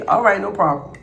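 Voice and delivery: ordinary talk, nothing marked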